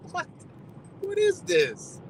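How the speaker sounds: high, slow, drawn-out what is this; stunned disbelief. Retold or imitated. Recalling the polite court clerk, slow high disbelief. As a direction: let the question hang in wonder. Quoting his own amazement.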